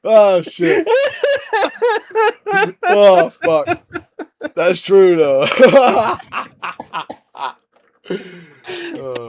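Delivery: very high, loud laughing through words; cracking up, barely able to speak; hysterical laughter. Real, not acted. Cracking up at the joke.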